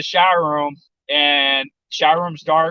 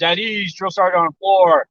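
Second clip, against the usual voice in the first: raised-pitch imitation of a barracks warning; mock scream; 5 semitones higher than usual. Trainees warning the bay of the sergeant, mock yelled call. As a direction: call it out higher, a half-strength yell. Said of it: "everyone screams".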